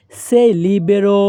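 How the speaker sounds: very slow, every syllable laid out clearly; slow teaching demo. Shown as a demonstration. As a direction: spell the phrase out slowly for learners. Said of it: "একসাথে বলি". (ধীরে ধীরে স্পষ্ট করে বলা)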